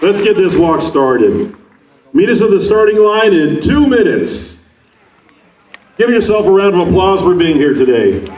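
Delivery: high, upbeat hype announcer; rally hype. Real, not acted. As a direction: fire up the crowd to start. Hyping walkers at start line.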